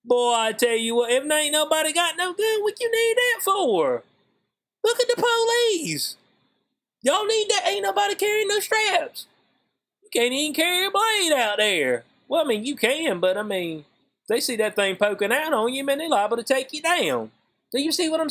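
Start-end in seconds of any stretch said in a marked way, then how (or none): 1.1-12.0 incredulous high protest. Real, not acted. Mocking gun rules, high-pitched.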